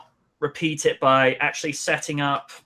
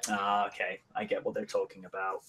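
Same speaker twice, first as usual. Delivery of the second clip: much softer knowing murmur, quick; knowing aside; 8 semitones lower, 13 dB quieter than usual. Retold or imitated. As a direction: mutter it like a private in-joke. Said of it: "moments where you're like".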